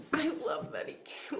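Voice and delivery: high, hushed emotional whisper. Real, not acted. Reader moved that the hawk came, emotional whisper. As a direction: whisper it, overcome with real feeling. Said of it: "Why am I so emotional?".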